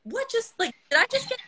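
very high, bewildered voice; mock dazed squeak of disbelief; imitated shock. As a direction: mimic a stunned bully. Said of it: "he was hurt and shocked".

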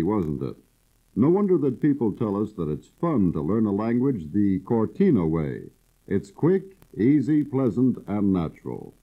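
usual speaking voice

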